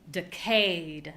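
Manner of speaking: "Decayed" stressed on CAYED; short light "De", held "cayed"; second-syllable stress. Shown as a demonstration. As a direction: lean on the end syllable of "Decayed". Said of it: "only gonna change the stress".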